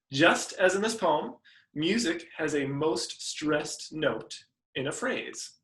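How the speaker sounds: usual speaking voice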